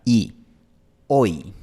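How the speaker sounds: "Oi" fuerte, de o a una i cerrada; diptongo español. Shown as a demonstration. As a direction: marca el "oi" español. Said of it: "Empezamos en la posición para la vocal española o". ("oi" español, cerrado)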